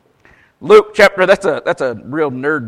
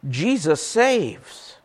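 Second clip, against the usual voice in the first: slow punchline; 3 semitones higher, 5 dB quieter, much slower than usual. Acted. Punchline of computer joke.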